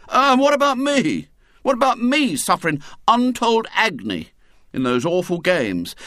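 high, loud indignant protest; outraged boy. A narrator's reading. William protests to his mother, high and indignant. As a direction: wail it like a wronged schoolboy.

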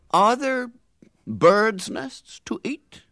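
high, slow and grand; majestic. Acted. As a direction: ask it like a king. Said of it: "said the red-headed man majestically".

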